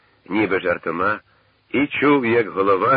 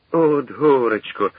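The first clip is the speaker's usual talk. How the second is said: loud, slow, raised wail of dismay; distressed lament; 6 semitones higher, 6 dB louder, slower than usual. A narrator's reading. Shovkun despairs at missing the lieutenant, distressed. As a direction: lament it loudly, drawing the words out. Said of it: "вдарив Шовкун об поле руками".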